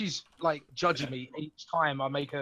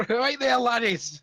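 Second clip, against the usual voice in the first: slow, higher-pitched Irish lilt, hammed up; mock Irish accent; 6 semitones higher, 4 dB louder, slower than usual. Player jokes about how Dwarvish sounds, in a mock Irish accent. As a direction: put on a drawn-out comic Irish accent. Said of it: "That's Irish".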